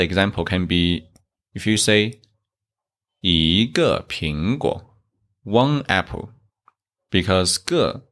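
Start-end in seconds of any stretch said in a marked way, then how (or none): 3.2-5.0 slow 一个苹果 with 一 rising; 一 in second tone. Shown as a demonstration. Teacher models one apple, 一 rising in slow 一个苹果. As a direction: glide 一 upward before falling 个, slowly. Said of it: "so E change to second tone".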